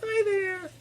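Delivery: very high, tiny put-on voice; high-pitched talking-dog voice; little dog's voice. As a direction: squeak a greeting as a small pet dog. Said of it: "Say hi, Coco".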